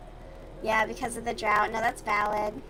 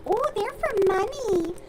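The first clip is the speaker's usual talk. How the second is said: put-on high, prissy old-lady voice; mocking old lady; 10 semitones higher, 4 dB louder than usual. Mocking an impressed old lady relative in a high prissy voice. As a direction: mimic the impressed old aunt. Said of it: "She's like".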